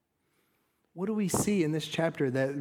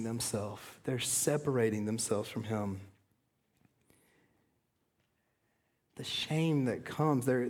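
sorrowful; 6 semitones lower, 5 dB quieter than usual. Acted. Preacher mourning Adam and Eve's shame. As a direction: let the grief weigh on each word.

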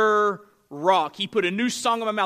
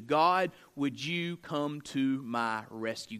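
humble prayer; 5 semitones lower than usual. Acted. Voiced prayer to God.

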